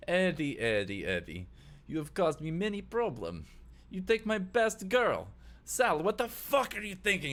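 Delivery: put-on high-pitched, scolding Russian accent; Russian crime boss reproaching an underling; Vlad, Russian accent. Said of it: "Russian accent".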